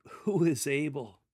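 a shaken question of awe; incredulous tremble. Shown as a demonstration. Solomon to God, incredulous trembling question. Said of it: "incredulous tone ... throat tremble".

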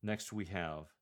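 ordinary talk

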